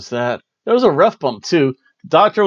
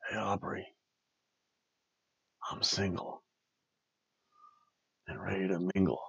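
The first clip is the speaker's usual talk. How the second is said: much softer, hushed whisper; flirty whisper; 5 semitones lower, 19 dB quieter than usual. Pretend. Host jokingly flirts with the referee in a whisper. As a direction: whisper the pickup line to her.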